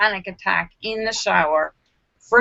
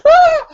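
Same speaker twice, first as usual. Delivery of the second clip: short high yelp, much louder; startled scream; 9 dB louder than usual. Retold or imitated. Phone rings during her panic, startled yelp. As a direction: jump at the ringing phone with a shriek. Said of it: "and I go".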